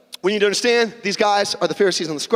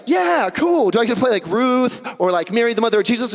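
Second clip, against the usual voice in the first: high, eager mock reply; eager volunteer; 5 semitones higher than usual. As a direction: sound thrilled to get a part. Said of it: "And you'd be like".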